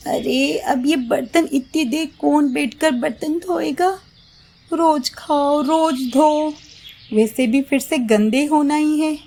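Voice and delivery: high lazy bahu whining; lazy daughter-in-law grumbling.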